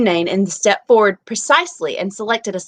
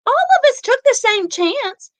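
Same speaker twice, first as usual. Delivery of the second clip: prim neighbor; 6 dB louder than usual. Acted. Neighbor rebuking Tessie.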